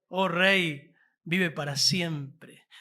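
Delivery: "vive para siempre" drawn out very slowly, each word weighed; slow reverent address. Shown as a demonstration. Preacher savours Daniel's respectful words to the king, slowly. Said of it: "cuando va ahí".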